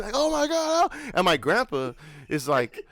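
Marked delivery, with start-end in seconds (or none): 0.1-1.1 high-pitched mock panic; imitated screaming. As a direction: cry out as screaming kids in a car. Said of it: "we start screaming".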